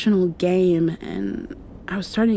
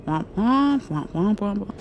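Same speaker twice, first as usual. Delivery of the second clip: womp womp. Retold or imitated. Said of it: "all I could hear was".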